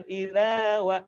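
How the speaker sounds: "Idza" with measured final a; swayed vowel. Shown as a demonstration. Teacher models the fix, swayed "Idza wa". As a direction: swing "Idza" briefly into "wa". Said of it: "cukup ayun".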